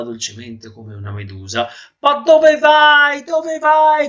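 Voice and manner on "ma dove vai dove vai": very high, loud yell; desperate shout. Acted. Noseless man yells after his departing nose, shouting high. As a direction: yell after someone leaving, stretching the words. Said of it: "gridò il signore".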